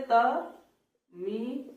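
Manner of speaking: "तमिल" said very slowly with a full l; standard "तमिल". Shown as a demonstration. Teacher showing the northern pronunciation. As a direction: draw out "तमिल" with a clear l. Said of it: "तमिल कैसे लिखते हैं".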